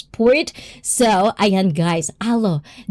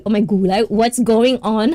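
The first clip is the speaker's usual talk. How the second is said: usual speaking voice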